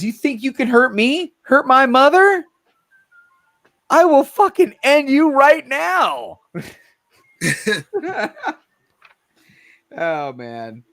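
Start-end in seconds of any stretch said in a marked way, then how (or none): 0.0-6.4 high, loud, slowing threat; furious little girl. Acted. Host reads the comic girl threatening the horses, high and furious. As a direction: play the tiny girl swearing revenge, dead serious. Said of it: "she goes".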